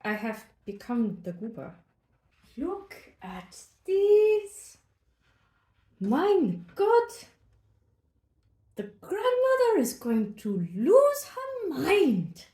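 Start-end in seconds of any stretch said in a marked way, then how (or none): 2.6-4.8 high, loud, stretched-out wonder; excited look. Real, not acted. Marvelling at the rolls. 6.0-12.5 a hint of a German accent, higher, louder, slow; hammed-up German marvel at the rolls. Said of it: "coach me in different accents".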